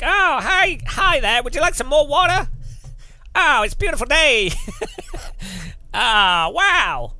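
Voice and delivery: high, loud, gushing American waiter patter; hammed-up super-chirpy corporate American server; over-positive American waiter. American waiter greeting a diner with menu pitch, hammed-up over-positive. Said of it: "go to America and a waiter serves you".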